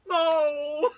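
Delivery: high loud cry breaking into laughter; shocked into helpless laughter; real shocked laugh. Real, not acted. Gamer watching the execution, laughing in shock. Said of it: "reacting to the execution".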